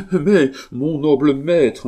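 high, loud laugh breaking into the words; nervous laughing protest. A narrator's reading. Servant cutting in on his master, high and laughing.

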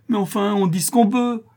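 very high, loud exasperation; half-crazed cry. A narrator's reading. Notary cornered by Cécilie's riddles, half-crazed. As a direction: burst out nearly out of your mind. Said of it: "s'écria le notaire presque insensé".

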